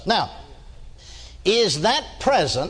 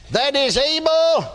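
high, drawn-out preacher intoning; preaching; 6 semitones higher than usual. Acted. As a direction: sing the verse out. Scripture read in preaching cadence.

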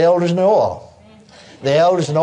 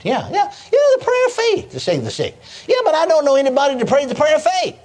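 high-pitched acted objection; protesting; 10 semitones higher than usual. Preacher replaying his argument with God, high insistent protest. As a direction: argue back, pitch climbing high.

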